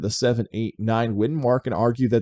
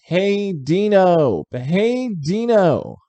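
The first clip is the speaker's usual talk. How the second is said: drawn-out, higher-pitched crowd cry; mock fan chant, slow and high; imitated fan shouting; 7 semitones higher, much slower than usual. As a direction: call it out like a fan, stretching each word. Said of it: "was screaming out".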